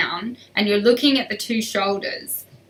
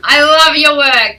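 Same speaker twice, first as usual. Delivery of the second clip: excited praise; 6 semitones higher, 12 dB louder than usual. Real, not acted. Praising a viewer's work.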